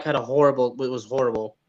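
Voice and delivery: usual speaking voice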